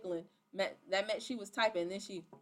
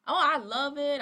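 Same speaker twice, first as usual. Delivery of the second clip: loud, raised burst of put-on enthusiasm; imitated over-keen reply; mock gushing; 4 semitones higher, 10 dB louder than usual. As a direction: gush it loudly, imitating the recruit. Acts out recruit's gushing reply.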